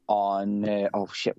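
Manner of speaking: ordinary talk, nothing marked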